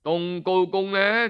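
ordinary delivery

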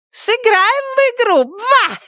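very high squeaky cartoon catchphrase. A narrator's reading. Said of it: "добавила она писклявым голосом".

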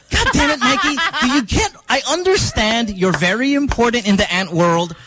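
high-pitched mock exasperation, easing down; retold exasperation. Host retelling an argument with a co-host over ants, mildly exasperated. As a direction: act out losing patience, pitch jumping up then settling.

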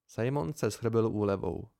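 usual speaking voice

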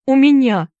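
high, loud tearful retort; grumbled reply. A narrator's reading. Crying boy answering his friend, grumbled retort. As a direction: blurt it out crossly. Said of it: "Уилл буркнул".

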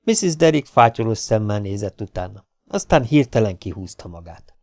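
usual speaking voice

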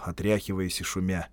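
plain reading voice

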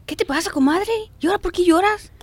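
high-pitched fussing comadre voice; gossipy comadre. Retold or imitated. Friend asks crying comadre why she weeps, high fussy voice. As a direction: play the worried friend, voice up high.

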